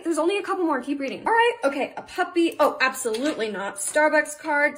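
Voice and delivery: normal talking voice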